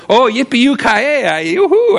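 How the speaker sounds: high-pitched mock whoop; mock celebration. Preacher mimicking someone cheering over troubles, mock celebration. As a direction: fake giddy cheer, rising to a high yoo-hoo. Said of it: "I may not celebrate".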